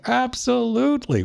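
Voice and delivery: high, mocking absolutely; sarcastic emphasis. Real, not acted. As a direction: sneer the word absolutely.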